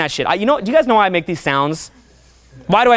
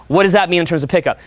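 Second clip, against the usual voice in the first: ordinary delivery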